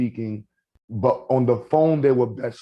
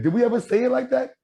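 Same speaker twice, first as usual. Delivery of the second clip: incredulous; 10 semitones higher than usual. Real, not acted. Incredulous question.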